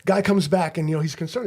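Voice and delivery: ordinary talk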